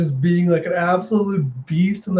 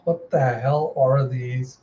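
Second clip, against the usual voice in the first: ordinary talk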